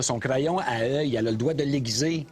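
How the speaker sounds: ordinary talk, nothing marked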